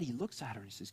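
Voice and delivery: ordinary talk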